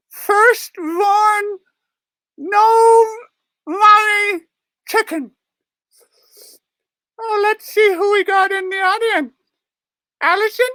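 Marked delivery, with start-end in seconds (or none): none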